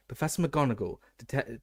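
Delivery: plain reading voice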